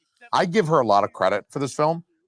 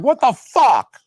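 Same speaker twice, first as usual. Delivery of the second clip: loud, high mock outburst; voicing the mom as she rounds on her son, loud; shocked yell; 12 semitones higher, 8 dB louder, much slower than usual. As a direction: blurt it out in disbelief, loud and high. Mom turns on the kid.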